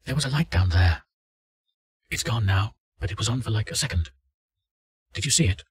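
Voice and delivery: urgent, uneasy report from the dark; frightened woman. Acted. Subject reports from the hole.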